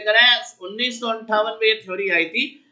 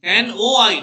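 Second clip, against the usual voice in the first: "NOI" spelt out, O punched louder; corrective stress; 5 dB louder, much slower than usual. Shown as a demonstration. Teacher correcting what students wrote, stressing O in "NOI". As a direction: hit the middle letter of "NOI" hard. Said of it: "not एआई".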